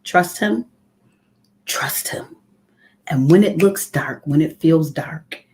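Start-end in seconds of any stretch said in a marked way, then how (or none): 1.7-2.4 soft whispered repeat. Shown as a demonstration. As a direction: breathe it like a secret. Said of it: "there's an old saying that says".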